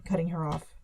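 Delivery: plain reading voice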